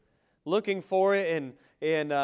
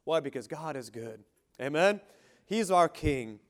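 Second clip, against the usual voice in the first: usual speaking voice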